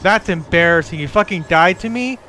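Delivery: loud mocking trash talk; raging gamer. Real, not acted. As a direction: rub it in, loud and cocky. Trash talk over voice chat.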